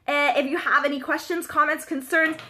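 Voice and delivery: normal talking voice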